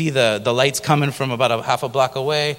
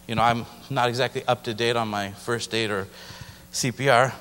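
normal talking voice

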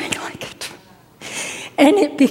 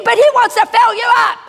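excited urging; 8 semitones higher, much faster than usual. Retold or imitated. Preacher urging teen to be filled.

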